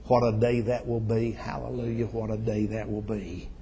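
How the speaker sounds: ordinary talk, nothing marked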